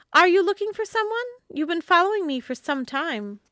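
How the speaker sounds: put-on young woman's voice. A narrator's reading. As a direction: call him out to his face.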